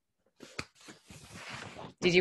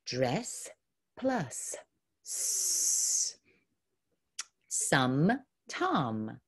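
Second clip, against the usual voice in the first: ordinary delivery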